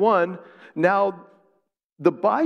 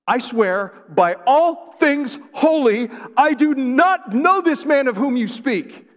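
louder, higher, slow and swearing hard; forceful oath; 10 semitones higher, 7 dB louder than usual. Shown as a demonstration. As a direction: hurl the oath with full force. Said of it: "That's the force".

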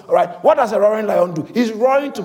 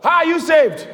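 calling out to church; 6 semitones higher than usual. Real, not acted. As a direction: throw the question out to the room. Pastor quizzes congregation.

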